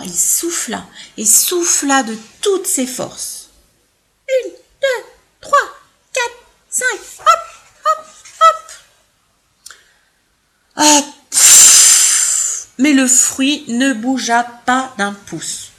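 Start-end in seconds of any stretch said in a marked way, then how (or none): 4.3-8.7 high, strained counting; straining effort. Acted. First elephant blowing hard at the fruit, straining. 10.8-12.7 exhausted puff. Acted. Elephant gives up blowing.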